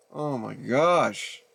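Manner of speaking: slow, drawn-out exasperation; exasperated sigh of words. Real, not acted. Vlogger waiting on failing player, exasperated. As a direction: drag it out, completely fed up. Fed up with missing audio.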